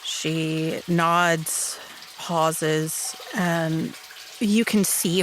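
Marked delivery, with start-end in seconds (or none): none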